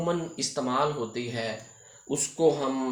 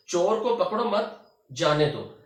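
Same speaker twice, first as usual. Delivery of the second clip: louder "चोर को पकड़ो मत", break, falling "जाने दो"; pause after मत; 3 semitones higher, 5 dB louder than usual. Shown as a demonstration. Teacher demonstrating the moved comma, short pause after "मत". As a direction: keep "पकड़ो मत" together, then pause before "जाने दो". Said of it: "इसमें हमने मामूली सा रुकना है".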